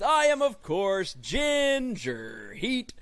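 loud, high, slow announcer voice; announcer intro. Acted. Host opening the podcast, big announcer voice. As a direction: sell the show opening like a host.

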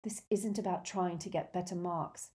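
plain reading voice

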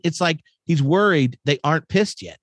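ordinary delivery

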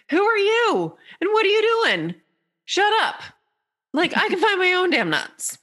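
very high-pitched, annoyed little squirrel; indignant squirrel. Acted. Squirrel telling off the unwanted advisor, indignant. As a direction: snap back in a high squirrel voice.

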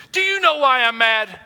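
loud, high-pitched angry question; mock-heated confrontation; acted spousal anger.

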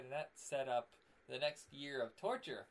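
normal talking voice

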